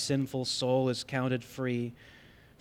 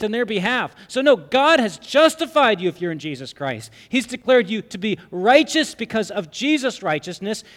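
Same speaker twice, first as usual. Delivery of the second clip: loud, high, driving sermon voice; full-force preaching; 7 semitones higher, 7 dB louder than usual. Acted. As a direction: preach it out with fire.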